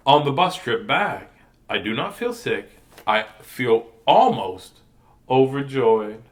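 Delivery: put-on gentle read-aloud to kids; picture book read to children; storybook reading.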